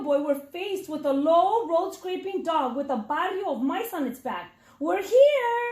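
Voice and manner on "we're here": high, loud little-mouse shout; small mouse shout. A narrator's reading. One little mouse announcing their arrival at the door, shouted high. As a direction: yell it out bright and high.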